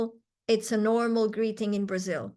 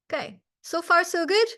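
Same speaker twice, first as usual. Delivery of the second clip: ordinary talk, nothing marked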